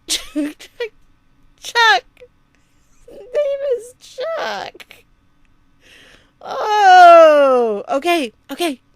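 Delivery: high shrieking laughter through the words; losing it in laughter mid-sentence; breaking character giggling. Real, not acted. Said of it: "I can't do it".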